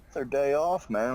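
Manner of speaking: high insistent protest; excited. Real, not acted. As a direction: pitch way up, insisting. Defending sleeping in.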